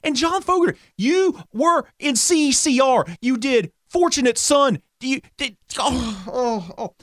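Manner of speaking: indignant rant breaking into groaned ohs; exasperated disbelief. Real, not acted. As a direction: sputter in pained disbelief. Ranting in disbelief at [name].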